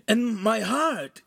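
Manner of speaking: high, drawn-out insistence; frantic pleading. Acted. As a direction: plead desperately with the demon. Ugolino pleads he is alive.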